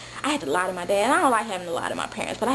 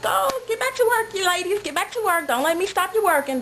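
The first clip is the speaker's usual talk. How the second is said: much higher chirpy encouragement; naive cheerful girl; 9 semitones higher than usual. Retold or imitated. Sheltered girl telling hookers to keep working, chirpy and high. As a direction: cheer them on like a clueless kid. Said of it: "I'm like".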